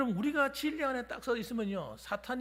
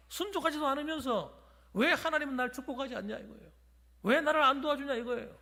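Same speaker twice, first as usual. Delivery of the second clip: higher, louder, driving sermon voice; fiery preaching; 5 semitones higher, 4 dB louder than usual. Real, not acted. Pastor rebukes congregation's complaining, loud fiery preaching. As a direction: press the point hard to the room.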